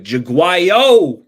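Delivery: loud, high, drawn-out holler; shouted name greeting. Real, not acted. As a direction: belt out the viewer's name in welcome. Calling out a chatter's name.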